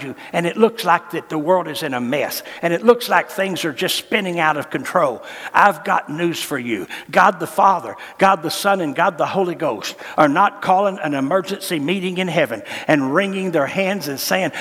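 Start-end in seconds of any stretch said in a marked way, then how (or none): none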